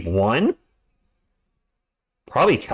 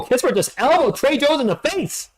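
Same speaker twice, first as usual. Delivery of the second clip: surprised outburst; 11 semitones higher than usual. Real, not acted. Surprised at foul.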